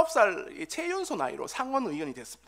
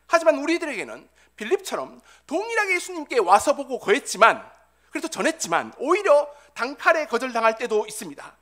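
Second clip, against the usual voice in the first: loud, raised-pitch preaching; forceful preaching; 7 semitones higher, 10 dB louder than usual. Real, not acted. Preacher telling the congregation of rejection and frustration, impassioned preaching. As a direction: drive each phrase home, building to a high peak.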